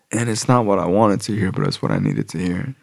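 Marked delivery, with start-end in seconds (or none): none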